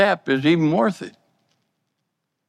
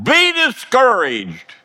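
mock shout; 10 semitones higher, slower than usual. Preacher shouting what discouragement never says. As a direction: bark it like a pushy inner voice. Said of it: "It doesn't shout".

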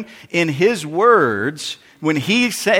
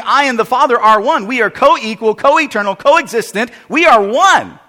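louder, rising preacher's cadence; preaching; 5 semitones higher, 4 dB louder than usual. Acted. Pastor preaching the Trinity to the congregation. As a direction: preach it with full conviction.

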